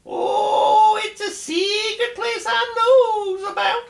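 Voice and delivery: high, giggling voice; laughing. Acted. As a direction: let the secret spill out through laughter, high. Rabbit teasing about laughing place.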